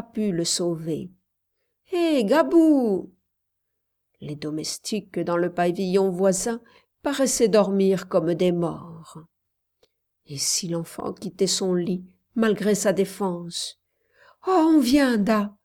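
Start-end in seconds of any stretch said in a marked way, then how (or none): 1.9-3.1 high, loud, drawn-out call; calling for help. A narrator's reading. 14.5-15.5 high, loud cry starting strong; child's exclamation. A narrator's reading. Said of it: "s'écria Mayotte".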